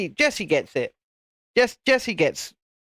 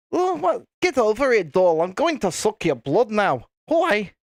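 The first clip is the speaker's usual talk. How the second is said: put-on Scottish accent, livelier and pitched up at the start; Scottish vampire; 4 semitones higher than usual. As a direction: play a vampire as a cheeky Scot. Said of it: "Imagine a Scottish vampire".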